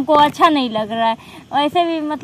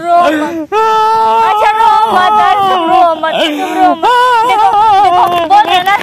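louder, fed-up yelling of रो मत; exasperated shouting; 3 semitones higher, 7 dB louder, slower than usual. Acted. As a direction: yell at him to stop crying. Shouting at crying friend to stop. (झुंझलाकर चिल्लाते हुए)

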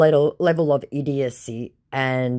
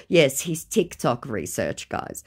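ordinary delivery

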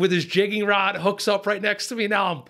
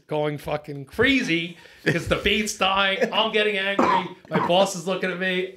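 ordinary talk

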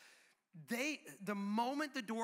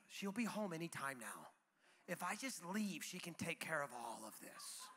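low, soft inner monologue; thinking to himself; 5 semitones lower, 8 dB quieter than usual. Acted. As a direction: scheme privately under your voice. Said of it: "And I think".

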